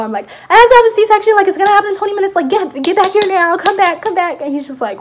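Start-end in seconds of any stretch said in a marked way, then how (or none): 0.5-4.4 high, loud, frantic rush; panicked phone call. Retold or imitated. Mother-to-be phoning her boyfriend to hurry back, panicked. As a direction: blurt it out urgently, pitched way up.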